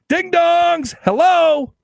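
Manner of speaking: high, exasperated jeer; mocking call-out. Host to Democrats who lost, loud-mouthed mocking disbelief. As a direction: call out to clueless people in disbelief. Mocks losing negotiators, calling them out.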